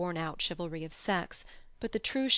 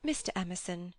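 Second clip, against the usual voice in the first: plain reading voice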